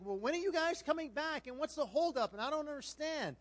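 high-pitched, impatient questioning; antsy parent. Retold or imitated. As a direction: imitate a fretful parent demanding answers. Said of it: "getting a little bit antsy about".

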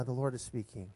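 normal talking voice

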